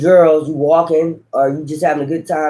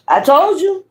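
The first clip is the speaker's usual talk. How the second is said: triumphant; 11 semitones higher, slower than usual. Real, not acted. Vindicated after the phone sound.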